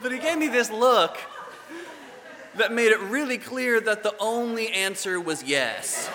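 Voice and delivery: laughing through the words, high and loud; can barely get it out for laughing. Real, not acted. Storyteller to the congregation, laughing hard.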